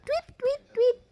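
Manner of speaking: put-on high birdy tweet for the child; bird chirp. Shown as a demonstration. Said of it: "Is it a bird?".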